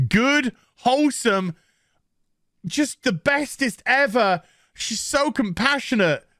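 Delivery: sarcastic praise. Retold or imitated. Vlogger mocking a show character with sarcastic praise. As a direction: lay the fake admiration on thick. Mock praise before calling her horrible.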